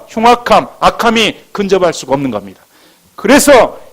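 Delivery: loud, high-pitched proclamation from the pulpit; full-force preaching. Real, not acted. Pastor assuring the congregation of safety inside the ark, preaching forcefully. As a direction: drive each promise home at full volume.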